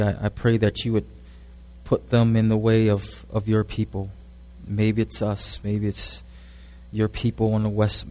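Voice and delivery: soft, hushed pastoral prayer. Acted. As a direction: pray quietly and earnestly to God. Pastor closing prayer before sermon.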